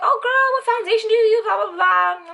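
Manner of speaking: gushing compliment. Retold or imitated. Ex-girlfriend complimenting her makeup, gushing. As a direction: gush over her makeup. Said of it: "she's complimenting my makeup".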